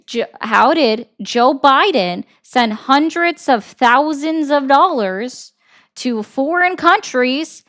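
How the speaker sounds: slow, over-enunciated question; deliberate, emphatic, word by word; slow, pointed. Real, not acted. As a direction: spell it out as if to someone slow. Restating ignored question very pointedly.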